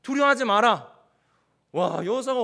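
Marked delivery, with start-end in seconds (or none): 0.0-1.0 sudden loud command; God's firm command. Acted. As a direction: deliver it as God's forceful word to a fearful leader. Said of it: "딱 하나님께서". (하나님의 단호하고 우렁찬 명령)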